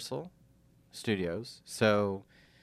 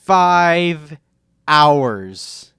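loud stunned emphasis; can't believe it, loud; astonished; 4 semitones higher, 13 dB louder than usual. Real, not acted. Stunned at five hour wait.

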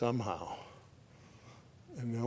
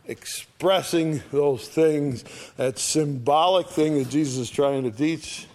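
ordinary delivery

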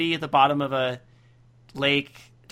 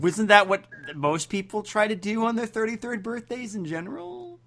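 puzzled; 6 semitones higher than usual. Real, not acted. Questions an obvious piece of advice.

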